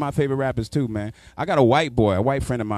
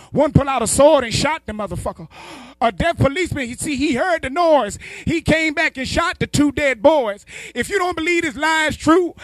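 high put-on uncle voice, louder, slowing in the middle; stoned uncle reciting a rhyme to his nephew, high and loud; 12 semitones higher, 4 dB louder than usual. Uncle recites a nonsense poem.

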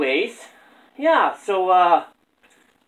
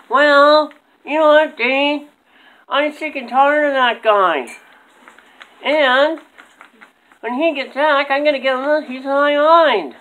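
high squeaky puppet voice; squeaky ventriloquist dummy; 6 semitones higher than usual. Acted. Dummy complaining about grandpa.

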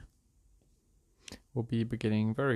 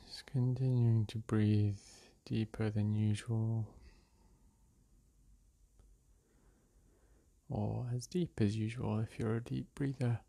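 unhurried meditation-guide voice with long pauses; guided meditation; slower than usual. Real, not acted. Meditation guide leading listeners through breath and release, calm and unhurried. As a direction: guide them gently through each breath, leaving space between phrases.

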